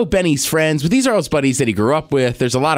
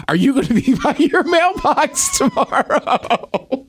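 high, slow mock-mom voice through a laugh; imitating mom; 10 semitones higher, slower than usual. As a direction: lightly mimic his mother asking, laughing. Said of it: "She goes".

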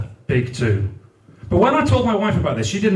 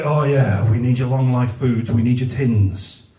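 mock charity advert; 5 semitones lower than usual. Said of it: "adverts for any food banks that say".

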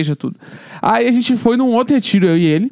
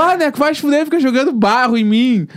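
higher-pitched, indignant mock complaint; imitated protest; 4 semitones higher than usual. Friend in the next shower complains about mud, high indignant voice. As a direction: play the outraged friend protesting. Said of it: "E aí ele começou".

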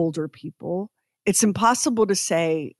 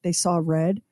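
normal talking voice

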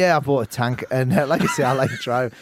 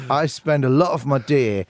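usual speaking voice

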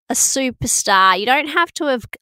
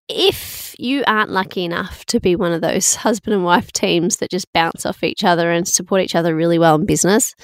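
usual speaking voice

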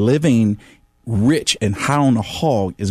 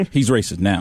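laughing. Retold or imitated. Repeats it while laughing.

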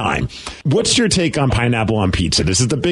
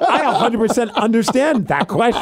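laughing imitation of Billy; 10 semitones higher than usual. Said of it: "He's like".

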